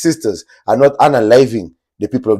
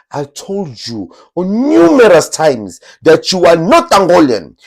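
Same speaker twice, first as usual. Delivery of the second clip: a hammed-up scolding fan, higher and louder; 6 semitones higher, 4 dB louder than usual. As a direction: play the scolding fan, pitched up. Reads scolding fan comment.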